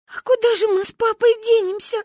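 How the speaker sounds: high-pitched tearful lament; bawling child. A narrator's reading. Manya wonders where she'll live, high bawling wail. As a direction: wail the question, dissolving into crying. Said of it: "зашлась в плаче Маня".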